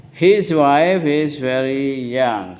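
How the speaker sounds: very slow, stretched-out classroom drill; repeat-after-me model. Shown as a demonstration. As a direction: draw out every word so learners can follow. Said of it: "Semuanya ulang".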